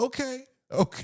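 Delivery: high sarcastic okay; mock agreement. Mocking his brother's confidence, high sarcastic okay. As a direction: sing it out like you know better.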